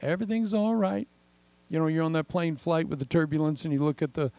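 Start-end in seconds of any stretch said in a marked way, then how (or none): 0.0-1.1 higher, light, soothing "it's all right"; a steady believer calming others on deck; calm reassurance. Retold or imitated. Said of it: "They're just like".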